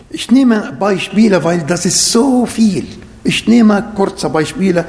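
ordinary talk

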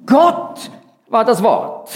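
"Gott" lifted and slowed at the front; stress on Gott. Shown as a demonstration. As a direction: lean on "Gott" first, then ease off. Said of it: "Es wird also doppelt betont".

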